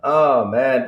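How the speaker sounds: usual speaking voice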